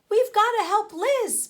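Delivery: high, loud and urgent; rally the others fast; girl urging friends to rescue. Acted. Urgent call to help.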